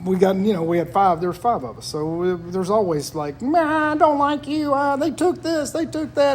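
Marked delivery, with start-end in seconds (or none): 3.4-6.3 put-on squabbling kid. A child whining to Mom about a sibling. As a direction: pitch it up like a bickering child. Kids squabbling voiced in story.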